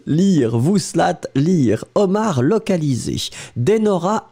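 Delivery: ordinary delivery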